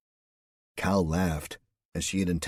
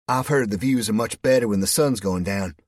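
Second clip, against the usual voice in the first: a louder, higher, lightly voiced American woman; Louise, flirty American; 4 semitones higher, 7 dB louder than usual. A narrator's reading. Louise teasing Cal over coffee, lightly voiced American woman. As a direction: play her teasing and easy.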